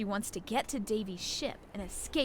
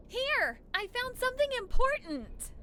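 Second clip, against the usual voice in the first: much higher, eager discovery; excited find; 11 semitones higher, 3 dB louder than usual. Acted. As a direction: call out the find with a thrill. Finds the diary.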